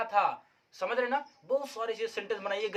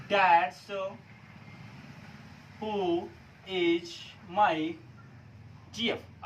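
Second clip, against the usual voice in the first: word by word with long pauses; slow dictation; 4 dB quieter, much slower than usual. Shown as a demonstration. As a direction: dictate slowly with gaps between words. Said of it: "कैसे बनाएंगे हम guys".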